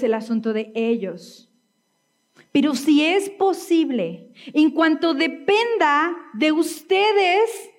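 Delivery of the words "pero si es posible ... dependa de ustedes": muy lento y agudo, palabra por palabra; versículo recalcado. Real, not acted. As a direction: recalca la condición estirando cada palabra, con tono alto. Repite el versículo, recalcando la condición. (versículo recalcado, lento y en suspenso)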